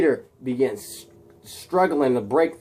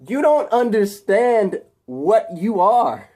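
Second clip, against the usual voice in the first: high, loud, slow proclamation; crazed villain; 9 semitones higher, 6 dB louder, slower than usual. Acted. Harry revealing to captive Peter what he is, crazed proclamation. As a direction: proclaim it slowly like a manic mastermind. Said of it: "Harry is crazy".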